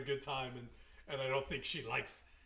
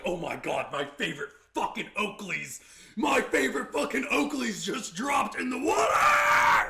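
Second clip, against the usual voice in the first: mock dismay building to shout; 7 semitones higher, 11 dB louder than usual. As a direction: wail over lost sunglasses, building up. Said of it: "He's like".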